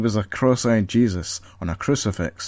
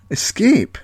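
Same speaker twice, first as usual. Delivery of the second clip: indignant surprise; 11 semitones higher than usual. A narrator's reading. Colin seizing on Paul's word.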